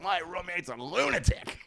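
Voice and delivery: high-pitched retold plea to a dispatcher; frantic complaint. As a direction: act out an exasperated emergency caller. Retold frantic call to emergency services.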